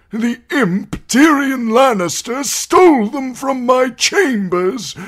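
put-on high, loud, slow quavering old voice, rising to a shout at the end; an aged maester accusing the imp, trembling; old maester listing poisons to the court; quavery old man. A narrator's reading. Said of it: "he said in a quavery voice".

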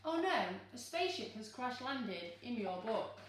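usual speaking voice

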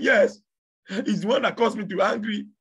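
high, loud accusation; accusing cry. Acted. Man accusing the devil.

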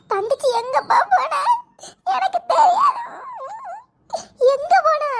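sobbing, shouting where did she go; frantic tearful cry; crying in panic. Acted. Mother cries out to father asking where the daughter went, sobbing.

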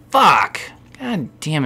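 real frustrated yell; angry outburst. Real, not acted. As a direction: blurt it out in anger. Gamer outburst.

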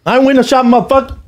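high, emphatic put-on voice; high-pitched cocky comeback; shopper's retort imitation. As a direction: mimic an indignant, excited buyer.

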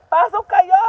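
loud, high call to the ducks. Real, not acted. Farm vlogger calling ducks into pen, loud high call. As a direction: shout them inside, pitch up.

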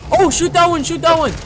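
high, loud urgent cry; frantic shout. Acted. Spotting a zombie near the car, high urgent shout. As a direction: yell the warning fast.

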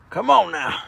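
usual speaking voice

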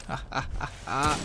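whispered mock-evil chuckle; breathy villain laugh. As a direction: gloat under your breath like a cartoon villain. Gloating while invisible.

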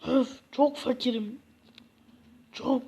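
normal talking voice

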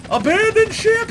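very high, loud cartoon cry; shrieked mock alarm; panicked shout. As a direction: yell it like a captain abandoning ship.

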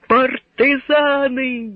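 high, slow joyful calling out; joyful shout. A narrator's reading. Someone in the crowd spotting partisans, joyful high call. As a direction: hail the partisans with joy. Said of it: "крикнув хтось радісно".

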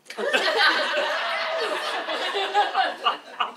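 shrieking high laugh; high burst of laughter. Real, not acted. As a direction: howl with laughter. Laughing at punchline.

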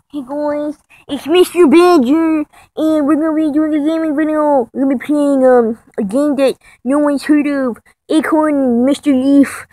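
loud, hammed-up video intro; mock gaming vlogger. Vlogger parodying a rival's gaming video intro, loudly. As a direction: push it out like a gamer greeting viewers.

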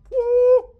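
high loud whoop; playful gym-bro whoop; mock hype. Real, not acted. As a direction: cheer the tiny weights mockingly. Finds two-pound weights.

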